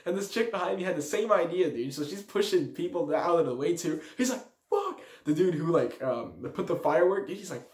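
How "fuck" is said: very high, squeaked curse; mock alarm. Man who lit the firework realises, mock alarm. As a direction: imitate a startled yelp.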